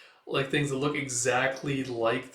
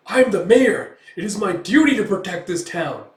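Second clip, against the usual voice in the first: loud, higher indignant official voice; mock mayor shouting; 7 semitones higher, 11 dB louder than usual. The mayor shouts back at the accusing swordsman, loud and indignant. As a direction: bellow it like a pompous mayor.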